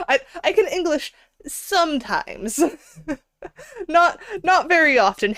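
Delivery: laughing. Real, not acted. Laughing at own English slip.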